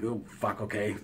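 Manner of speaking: low, hushed aside; muttered inner reaction. Retold or imitated. Inner reaction on seeing the look.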